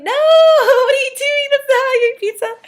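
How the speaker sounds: high, loud, laughing disbelief; a sibling horrified at knife and fork pizza; mock outrage. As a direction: squeal it like you can't believe it. Scolding sibling about pizza.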